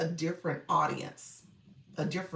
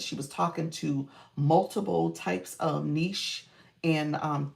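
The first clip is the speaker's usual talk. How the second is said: usual speaking voice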